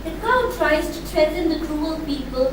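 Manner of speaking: ordinary delivery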